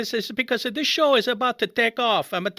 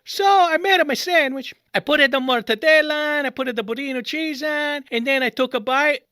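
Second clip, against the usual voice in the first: usual speaking voice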